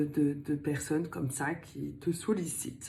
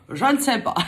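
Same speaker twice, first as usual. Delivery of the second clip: laughing; 8 semitones higher, 7 dB louder, faster than usual. Real, not acted. Laughs at her own accent.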